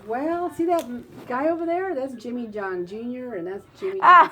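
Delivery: high, loud mock voice of the lady; imitating the lady. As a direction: squeal it out like the lady did, laughing. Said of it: "She goes".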